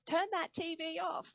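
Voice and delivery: high-pitched imitation of a mum's yell. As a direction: call out like mum from another room, pitched well up. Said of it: "and Mum yelled out".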